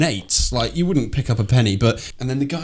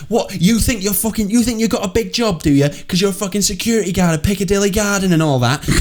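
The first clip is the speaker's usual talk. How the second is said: high-pitched mocking tirade; mouthy sneering woman; 8 semitones higher than usual. Woman slagging off a security guard's job, high mouthy sneer.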